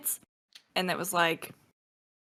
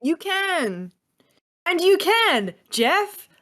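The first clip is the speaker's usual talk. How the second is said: high, loud salesman pitch; infomercial voice; 9 semitones higher, 4 dB louder, much slower than usual. Acted. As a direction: pitch it like a TV ad host. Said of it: "said that like an infomercial".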